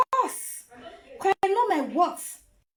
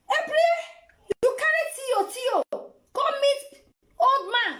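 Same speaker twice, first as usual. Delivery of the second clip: mocking disbelief; 8 semitones higher than usual. Real, not acted. Mocking the woman in the clip.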